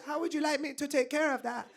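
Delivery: high, soft, polite voice; salon lady act. Retold or imitated. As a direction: ask it delicately, high and hushed.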